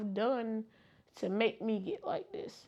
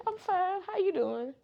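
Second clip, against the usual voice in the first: imitating a bright, higher, put-on fine; fake cheerful fine; 7 semitones higher than usual. Answering neighbours with a mock cheerful I'm fine. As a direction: brush it off with a sunny I'm fine. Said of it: "Or, 'Oh, how's so-and-so doing?'".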